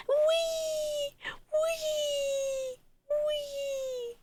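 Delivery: very high, long "wee"; thin, high gleeful glide; playful squealing. Real, not acted. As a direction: squeal it like spinning round on a ride. Squealing as she shows the model.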